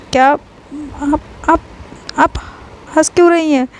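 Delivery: falter, unsure why they laugh; nervous stammer. A narrator's reading. Scared Lovely sees them laughing.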